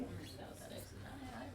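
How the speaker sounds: teasing soft whisper. Real, not acted. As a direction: breathe it out jokingly. Teasing reply to the voice.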